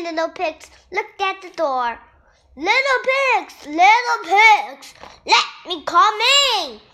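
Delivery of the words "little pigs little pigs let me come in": yelling wolf. A narrator's reading. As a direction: shout it through the brick door. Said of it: "yelled the wolf".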